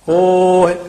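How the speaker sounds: ਹੋਏ as a held "hooo-he"; long o, short ending. Shown as a demonstration. Teacher drilling vowel lengths, long o in ਹੋਏ. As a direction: stretch the o of ਹੋਏ, lightly close it. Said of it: "ਹਾਹੇ ਦਾ ਹੋੜਾ ਲੰਮਾ".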